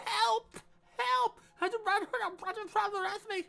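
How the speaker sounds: very high, fast, garbled cries; mock hysterical plea. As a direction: squeal it like a panic. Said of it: "was that even English?".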